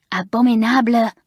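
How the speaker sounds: loud raging cry of the word Abominable. A narrator's reading. Mamselle throwing the pincushion away, loud angry cry. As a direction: spit out Abominable in fury. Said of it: "she raged".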